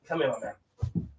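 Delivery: slow, soft pet-talk voice; coaxing the dog. Real, not acted. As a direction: coax the dog over gently. Coaxing his little dog.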